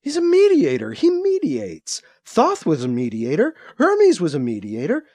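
ranting mother on live TV; frantic rant. A narrator's reading. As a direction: pour it out like a woman unravelling. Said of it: "Ruth continued ranting".

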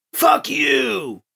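high, strained shout; drawn-out, high-pitched angry yell. A narrator's reading. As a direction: yell it out from a prison cell. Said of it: "came a yell from the cell".

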